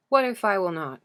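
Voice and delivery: a cold, rigid challenge thrown down; stiff. A narrator's reading. Achilles defies the begging king, stiff and loud. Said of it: "His words have gone stiff".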